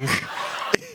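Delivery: high breathy laugh. Real, not acted. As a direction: burst into an airy high laugh. Laughs while recalling bawling.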